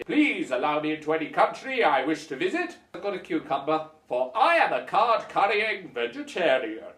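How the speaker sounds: loud, declaimed official request; mock-pompous traveller. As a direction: proclaim it like a self-important card holder. Acting passport holder at airport.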